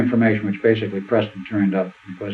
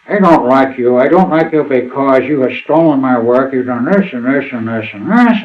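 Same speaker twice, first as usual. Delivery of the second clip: imitating a drunk caller, louder and slurring; drunken voice; 3 semitones higher, 5 dB louder than usual. A drunk caller accusing Preston of stealing his work, mock slurred and louder. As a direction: play the drunk caller ranting, a bit loud. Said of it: "speaking on the phone in a very drunken voice".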